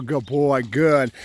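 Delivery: ordinary talk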